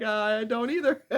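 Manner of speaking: laughing through the words. Retold or imitated. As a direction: giggle it out. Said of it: "I'm like".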